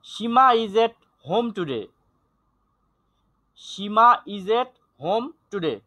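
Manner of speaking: very slow, deliberate teacher's reading; slow, word-by-word classroom reading; model reading. Shown as a demonstration. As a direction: read out slowly for students to follow. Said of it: "teacher's model reading".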